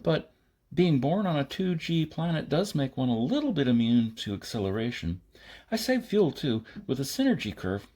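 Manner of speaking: put-on high, breezy young woman; cheerfully unbothered young pilot; bright girl's voice. Maida explains cheerfully.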